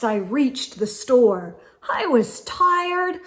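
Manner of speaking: plain reading voice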